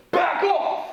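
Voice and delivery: loud, high commanding shout; strong voice. Shown as a demonstration. Teacher models warning Doc Ock, loud commanding shout. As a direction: stop a villain cold with your voice. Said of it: "let me hear your phrase".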